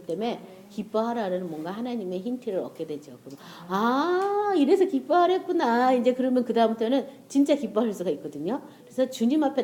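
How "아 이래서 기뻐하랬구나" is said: realization. Retold or imitated. Sudden understanding of God's reason.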